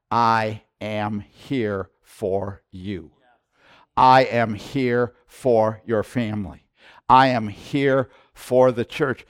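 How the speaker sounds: very slow, weighty words of the Lord; voicing the Lord to his servant, slow and solemn; slow solemn promise. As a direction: let each I am land slowly. Quoting the Lord's reassurance.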